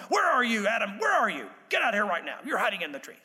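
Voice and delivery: mock scolding parent calling a child out; sharp telling-off, each phrase punched and falling; angry parent. Parent to a child who did something wrong, mock-angry scolding. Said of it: "like your mom did or your dad did".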